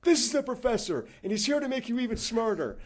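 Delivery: officer addressing his operators, loud and high; commanding shout. Retold or imitated. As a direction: call the room to attention like a commander. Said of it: "he points to them and goes".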